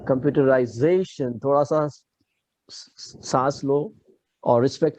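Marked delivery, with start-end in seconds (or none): 0.1-1.4 computerization with a drawn accent on "za"; stress on penultimate ZA. Shown as a demonstration. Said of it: "Put accent on that particular syllable".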